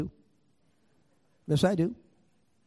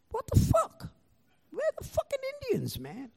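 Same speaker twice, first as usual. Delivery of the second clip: very high, hushed squeal; tiny high-pitched voice of bewilderment; incredulous; 10 dB quieter than usual. Retold or imitated. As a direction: squeak it softly in mock disbelief. Retold incredulous reaction.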